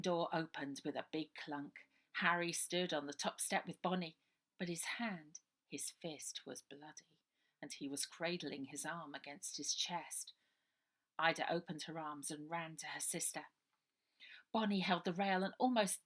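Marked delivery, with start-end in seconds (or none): none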